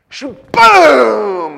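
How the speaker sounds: high, loud playful blast; comic bang of a shout; mock shout. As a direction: fire it off like a joke punch.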